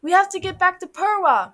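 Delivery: high, loud call to companions; urgent cry. Acted. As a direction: call out urgently while fleeing. Child calls out while being chased.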